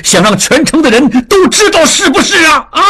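high, shouted rebuke, slowing to bitter scorn; furious. A narrator's reading. Angry father shouts at wife over their daughter's shame. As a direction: roar at the women to stop crying. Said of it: "江父怒道".

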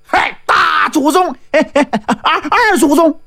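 high-pitched, chuckling battle taunt; mock the enemy in a high voice with little chuckles; taunting generals. A narrator's reading. Two generals taunt the Taoist.

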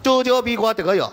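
丢丢鼻管得有 with a slurred tongue; lisping 丢丢鼻管得有 through a thick tongue; big-tongue lisp. Shown as a demonstration. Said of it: "针儿尖儿、鼻管儿".

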